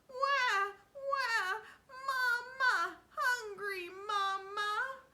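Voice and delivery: put-on high, loud wailing baby voice; baby crying. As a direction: wail like a hungry baby. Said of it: "called out as if he was a baby".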